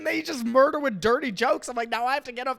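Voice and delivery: high, loud, indignant rant; panicked complaint. Retold or imitated. Comic protesting he must follow with clean jokes, panicked high complaint.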